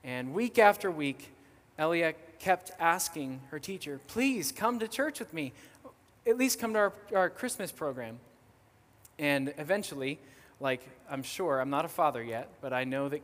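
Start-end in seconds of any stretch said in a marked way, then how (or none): 4.1-8.2 higher-pitched mock child's plea; imitating a child who won't give up; persistent pleading. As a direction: keep begging like an insistent kid. Child keeps asking her teacher.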